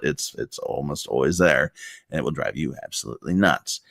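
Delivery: ordinary talk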